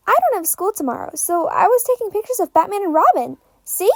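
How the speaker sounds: a hint of a small boy showing off his photos; eager little boy. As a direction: talk fast like a proud young fanboy. Tim, a lost nine-year-old.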